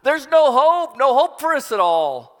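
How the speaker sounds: high, loud wail of despair; panicked cry. Acted. Terrified servant cries out to the prophet, panicked. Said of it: "this servant cries out to Elijah".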